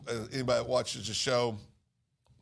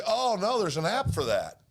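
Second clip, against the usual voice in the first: higher, mock-breezy quote; mimicking what people say; 5 semitones higher than usual. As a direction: parody a glib dismissal, pitched up at the start. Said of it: "where we say".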